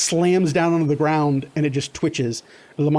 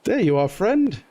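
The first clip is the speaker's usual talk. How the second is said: gentle, higher mock-tender coo; coaxing Lamont; 6 semitones higher than usual. Lamont tucking the rat away, gently cooing. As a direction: soothe the little buddy. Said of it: "He goes".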